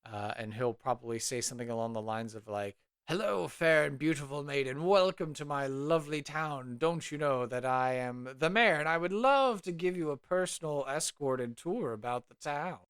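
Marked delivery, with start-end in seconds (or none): 3.1-12.8 pompous mayor. Acted. Mayor welcoming a maiden to his town, pompous. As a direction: lay on grand, preening courtesy. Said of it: "this mayor who is, uh, strutting about like a peacock".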